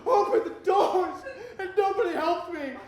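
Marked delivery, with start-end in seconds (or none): 0.0-1.2 loud, high pleading yells; panicked screaming. Retold or imitated. Trapped passenger screaming at the driver to open the doors, loud panicked plea. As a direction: beg at full volume for the doors. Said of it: "just screaming".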